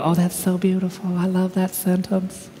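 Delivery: mock-dreamy gushing in a changed, airy voice; gushing reader. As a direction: coo it like a sentimental admirer. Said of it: "We read it, we go".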